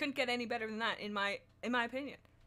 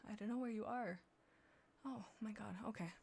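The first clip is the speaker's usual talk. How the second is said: soft whispered aside; 10 dB quieter than usual. Real, not acted. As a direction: breathe it out under your breath.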